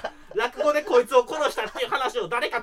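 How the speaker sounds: loud, high, drawn-out yelling rant; comic angry shout. Pretend. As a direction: yell it in mock outrage. Angry outburst at partner. (わざと怒った絶叫)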